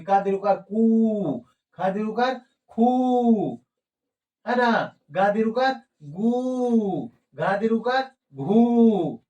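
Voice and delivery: slow lengthened ऊ demo; drawn-out long vowel. Shown as a demonstration. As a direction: stretch कू, खू, गू, घू slowly and long. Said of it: "इसमें देर की मात्रा हो जाती है".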